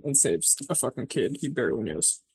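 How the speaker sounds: normal talking voice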